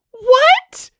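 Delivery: very high, very loud shocked cry; boy shocked by a reveal about his father; shocked yell. A narrator's reading. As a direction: shriek it in disbelief. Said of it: "Izuku yelled".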